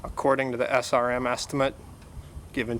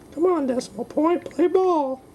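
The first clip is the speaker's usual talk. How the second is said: high coaxing voice; 13 semitones higher than usual. Acted. Coaxing the app.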